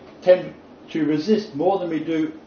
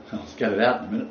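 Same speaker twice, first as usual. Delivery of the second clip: ordinary talk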